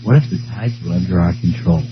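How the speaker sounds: deep, soft, dreamy musing; a faraway grand announcement; low dramatic voice. Pretend. Mock campaign musing.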